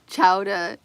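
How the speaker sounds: Boston chowder. Shown as a demonstration. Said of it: "Say chowder".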